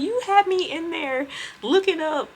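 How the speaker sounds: high falsetto grievance, hammed up; mock outrage. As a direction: imitate the supervisor scolding. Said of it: "she was like".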